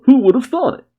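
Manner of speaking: high, hammy exclamation; delighted. Acted. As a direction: crow it out cheerfully.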